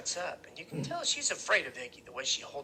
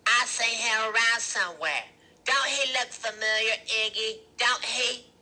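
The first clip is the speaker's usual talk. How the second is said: high, loud woman's voice; Loretta's character voice; 7 semitones higher, 7 dB louder than usual. A narrator's reading. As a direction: put on a louder, higher character voice. Loretta's voice in narrated story.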